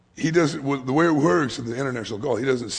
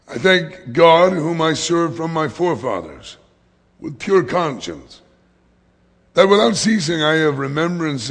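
loud, raised voice reading a Bible verse; declaimed scripture reading; 2 semitones higher, 7 dB louder than usual. Real, not acted. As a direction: read the verse out to the congregation. Reads scripture aloud.

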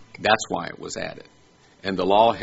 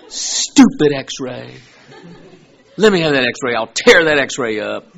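hammed-up fury at a stupid X-ray, higher and louder; mock angry rant; 5 semitones higher, 8 dB louder than usual. Mock-angry patient snapping at the X-ray. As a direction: pretend to be furious at the X-ray.

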